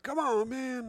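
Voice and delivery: a light Tom Cruise take on the catchphrase; Tom Cruise impression. Joking that Tom Cruise says it too, light impression. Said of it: "Tom Cruise is like".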